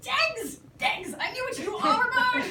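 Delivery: high, loud triumphant cry with laughter; gleeful gotcha; excited. Acted. George thinking he's solved it, excited shout.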